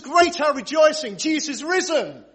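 high, loud, rousing rejoicing; exultant rallying cry; jubilant proclamation. Shown as a demonstration. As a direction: proclaim it to whip up the crowd.

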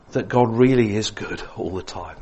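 ordinary talk

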